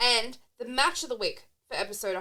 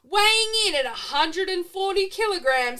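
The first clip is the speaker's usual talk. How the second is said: booming ring announcer, building louder and higher; 9 semitones higher, 10 dB louder, slower than usual. Pretend. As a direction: announce him like a main event. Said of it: "put on your best ring announcer voice".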